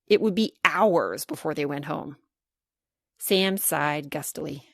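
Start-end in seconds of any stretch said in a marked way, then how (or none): none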